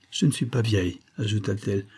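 plain reading voice

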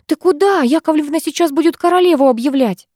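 high, urgent scolding of a friend; urgent hiss. A narrator's reading. Friend stopping her before the queen is announced, hissed urgently. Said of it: "зашипела Викуля".

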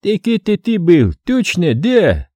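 a touch of fake surprise; feigned surprise. A narrator's reading. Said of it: "Притворно удивился Сулейман". (притворное удивление)

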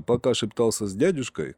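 usual speaking voice

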